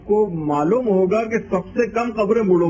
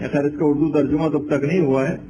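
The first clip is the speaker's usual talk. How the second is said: usual speaking voice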